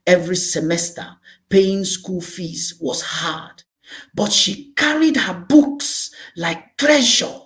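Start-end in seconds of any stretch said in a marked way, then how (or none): none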